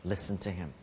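low, soft solemn voice of God; Father's voice. Retold or imitated. The Father speaking over his beloved Son, low and solemn. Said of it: "the Father's voice said".

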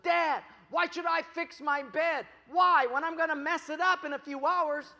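put-on high, loud kid's complaint; protesting son. Son asks dad why he should fix his bed, loud protest. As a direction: argue back high and loud, like a put-upon kid.

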